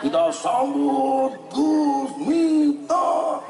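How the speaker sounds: high, drawn-out fight MC intro; boxing ring announcer. Retold or imitated. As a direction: stretch the name like a boxing MC. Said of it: "Malah kaya M C tinju". (gaya MC tinju sing dawa lan dhuwur)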